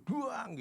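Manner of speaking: high-pitched imitated crash; tiruan bunyi tabrakan. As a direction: voice a car smash as a sound effect. Meniru bunyi mobil ditabrakkan. (tiruan bunyi benturan mobil)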